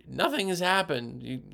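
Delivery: ordinary delivery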